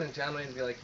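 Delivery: normal talking voice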